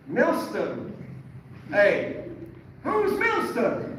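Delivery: high, loud, drawn-out question; loud puzzled rabbi. Retold or imitated. Rabbi reading out grades, loud baffled Irish voice. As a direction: call out the unknown name in disbelief. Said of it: "he's reading out the grades and he said".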